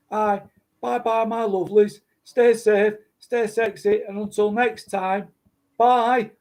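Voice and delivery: put-on northern English accent, sing the goodbye up high; [name] northern accent. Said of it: "I'd probably do it in a northern accent".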